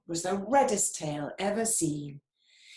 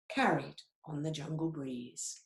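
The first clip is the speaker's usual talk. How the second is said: usual speaking voice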